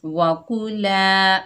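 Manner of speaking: slow, drawn-out Quranic recitation. Shown as a demonstration. Teacher reciting the word for pupils, slow chanted recitation. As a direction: recite it slowly, like tilawat. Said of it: "एक अलिफ की मिकदार खींच कर पढ़ेंगे".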